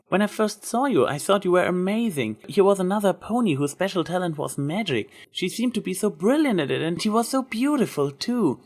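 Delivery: lightly voiced Twilight, admiring; Twilight's voice. A narrator's reading.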